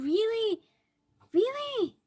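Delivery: high-pitched, incredulous question; surprised. Real, not acted. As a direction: jump up high in disbelief.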